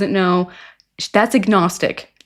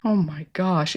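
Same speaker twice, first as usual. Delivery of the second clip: low, hushed dismay; appalled murmur; 5 semitones lower, 8 dB quieter than usual. Real, not acted. Commentator aghast after the failed meeting, appalled murmur. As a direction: drop low and soft, aghast.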